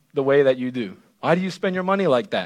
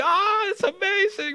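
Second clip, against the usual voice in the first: much higher, hammed-up gushing sigh; mock swooning guest; 3 dB quieter than usual. Wedding guest gushing over the ceremony, high mock sigh. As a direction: gush like a teary wedding guest. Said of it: "and it's like".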